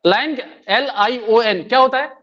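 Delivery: ordinary talk, nothing marked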